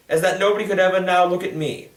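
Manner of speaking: plain reading voice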